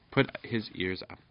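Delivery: plain reading voice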